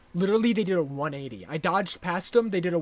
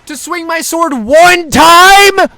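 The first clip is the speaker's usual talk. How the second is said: high, loud, slow outraged yell; furious shout; 11 semitones higher, 21 dB louder, slower than usual. Real, not acted. Gamer raging about one sword swing, high furious yell. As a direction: scream the question at the game. Raging after wasting a revive.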